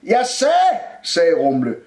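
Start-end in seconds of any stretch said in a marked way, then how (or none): 0.0-0.8 high, loud insistent repeat; shouting. A narrator's reading. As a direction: raise the voice to be heard. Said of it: "bliver jeg nødt til at råbe". (råber insisterende)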